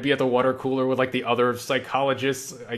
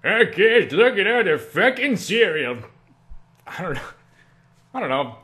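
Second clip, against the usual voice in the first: high, loud cartoonish ranting; crazy self-talk voice; 6 semitones higher, 4 dB louder than usual. Shown as a demonstration. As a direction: marvel at breakfast in a deranged silly voice. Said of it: "and it's like".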